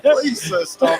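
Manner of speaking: high, loud comic begging; mock pleading. Rookie begs the big opponent to stop, loud high mock plea. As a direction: beg like a put-upon schoolboy.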